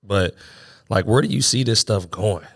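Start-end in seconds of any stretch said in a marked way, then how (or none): none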